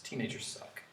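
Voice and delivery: breathy mock grumble; whispered aside. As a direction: mutter it under your breath. Aside muttered.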